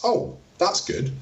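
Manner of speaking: high, slow, approving "Oh, that's good."; pleased praise. Shown as a demonstration. Parent praising teen for cleaning room, slowly and high.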